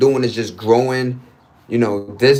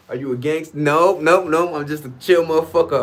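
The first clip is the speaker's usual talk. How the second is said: ordinary talk, nothing marked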